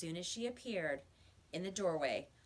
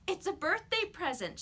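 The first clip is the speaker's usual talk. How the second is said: put-on proud little girl; 11 semitones higher, 3 dB louder than usual. A narrator's reading. Girl shows off gift.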